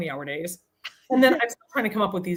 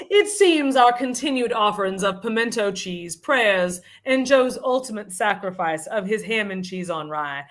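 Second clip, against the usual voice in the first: hammed-up Southern proclamation; affected Southern accent. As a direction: read it like a grandly formal Southern lady. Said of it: "in this very affected Southern accent".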